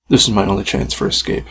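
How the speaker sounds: ordinary delivery, nothing marked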